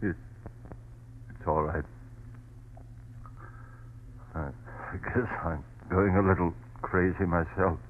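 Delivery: quiet dazed murmur; drained. Acted. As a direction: speak hollow and worn out. Husband exhausted after outburst.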